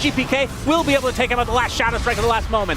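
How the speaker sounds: excited esports fight call; urgent running match commentary; live play-by-play. Real, not acted. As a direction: drive the commentary as the fight builds.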